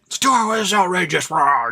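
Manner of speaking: high, strained mock outrage; hammed-up angry sputtering; pissed-off customer. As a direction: rant like an indignant customer, ending in a growl. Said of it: "already pissed off and angry".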